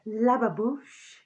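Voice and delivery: Lababouche stretched out slowly as la-ba-boosh, rising; slow, relishing la-ba-boosh; savouring a word. Shown as a demonstration. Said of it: "It's got a bit of a, a twang to it".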